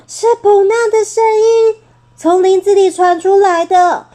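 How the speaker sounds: plain reading voice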